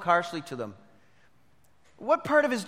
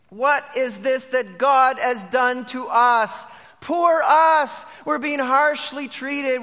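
raised, slow mock complaint; mock self-pity; 6 semitones higher, 6 dB louder, slower than usual. Preacher voicing the brothers as they blame God, mock self-pity. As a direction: play up the "poor us" grievance, louder on those words. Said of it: "from poor me to poor you".

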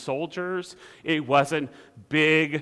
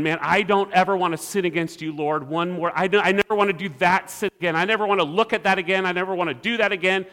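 fast, insistent acted pleading; mock earnest vow; 2 semitones higher, faster than usual. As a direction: rattle off promises like a repentant sinner. Acts a sinner vowing to God.

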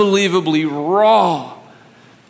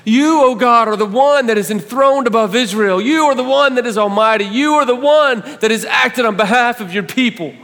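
much higher and louder, shouted praise; triumphant proclamation; 8 semitones higher, 4 dB louder than usual. Acted. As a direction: proclaim God's greatness with full force. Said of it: "in the next breath he's saying".

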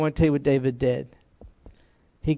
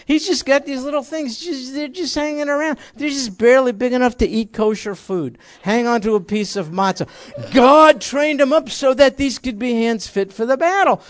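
higher and louder, building to the point; high loud preaching; 7 semitones higher, 4 dB louder than usual. Real, not acted. Preacher to the congregation about David's hands, high and loud. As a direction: ramp up the fervor.

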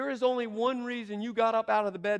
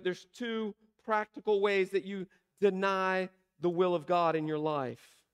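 ordinary talk, nothing marked

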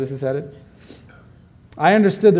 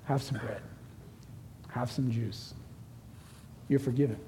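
tender; 3 semitones lower, 14 dB quieter than usual. Acted. Voicing the Savior at communion.

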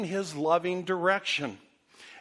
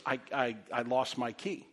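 upset and shaken; 6 semitones lower, 6 dB quieter than usual. Retold or imitated. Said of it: "I can tell in her voice right away something's wrong".

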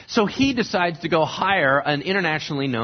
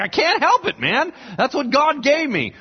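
helpless protest; 9 semitones higher than usual. Retold or imitated.

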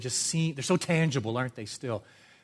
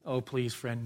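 usual speaking voice

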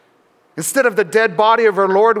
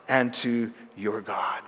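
whisper; 8 semitones lower, 9 dB quieter, slower than usual. Acted. Jesus' words, hushed ending.

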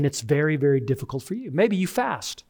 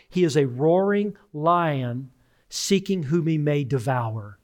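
slow scripture quote; slower than usual. Shown as a demonstration. Quoting scripture about the devil.

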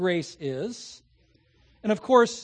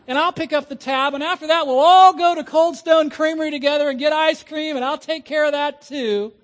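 high, loud, building offer; excited generosity; 6 semitones higher, 6 dB louder than usual. Acted. Officer treating the ticketed driver's family after dinner, excited and generous. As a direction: pile on the treats with rising excitement.

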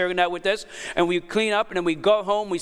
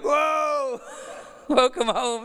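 high, laughing cry of welcome home; laughing mock shout; 7 semitones higher, much slower than usual. Pastor laughing at his son being sick at home. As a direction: yelp it up high, then laugh it out slowly.

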